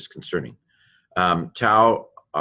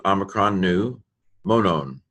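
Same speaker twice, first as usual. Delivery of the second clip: ordinary talk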